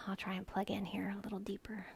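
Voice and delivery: whispered aside while fixing the mic; quick under-the-breath whisper. Real, not acted.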